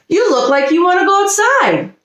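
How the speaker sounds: sweet and warm to the pups; kindly high. A narrator's reading. Nanny to puppies, higher.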